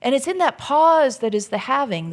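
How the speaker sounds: ordinary talk, nothing marked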